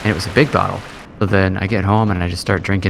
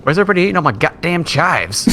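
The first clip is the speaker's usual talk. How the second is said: mock exasperated rant; 7 semitones higher, faster than usual. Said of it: "And I'm like".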